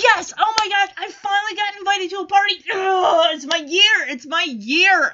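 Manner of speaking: loud, high celebratory shouting; overjoyed. Acted. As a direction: crow it like you finally got invited. Charlie Brown overjoyed at party invitation.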